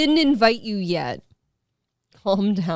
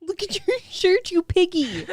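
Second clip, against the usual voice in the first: teasing high voice; 10 semitones higher than usual. Real, not acted.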